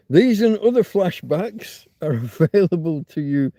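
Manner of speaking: announcer voice. Acted. Streamer plays a TV announcer offering nostalgic flashbacks. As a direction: sell it like an old TV promo.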